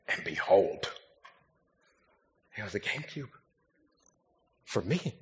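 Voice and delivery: low, very soft, pausing between phrases; hushed awe. Retold or imitated. Preacher retelling his discovery of the GameCube, in hushed awe. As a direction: drop to a reverent hush, stretching out the suspense. Stunned discovery in the retold story.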